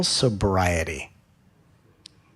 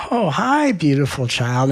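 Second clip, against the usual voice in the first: sweetly coaxing greeting; 3 semitones higher, slower than usual. Retold or imitated. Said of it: "And you go".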